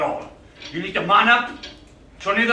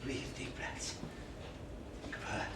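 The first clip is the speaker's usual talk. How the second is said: breathy hushed self-coaching; whispered through pain; 6 semitones lower, 19 dB quieter than usual. Acted. Man in pain steadying himself.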